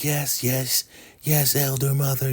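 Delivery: overdone meek agreement; laying it on thick. Retold or imitated. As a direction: play the obedient follower, hamming it up. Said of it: "she's really playing it real thick".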